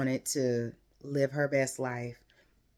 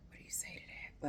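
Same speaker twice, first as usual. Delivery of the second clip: soft breathy whisper, much quieter; stunned whisper; 15 dB quieter, faster than usual. Retold or imitated. Voicing Noelle's speechless thought, a soft whisper. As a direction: whisper it like you're lost for words.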